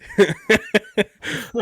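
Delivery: real laugh at a friend's joke; high burst of laughter. Real, not acted. Laughing at the hoagie bit.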